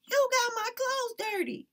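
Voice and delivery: put-on high, loud childish wail; little boy wailing over dirty clothes. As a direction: whine it like a hurt little boy.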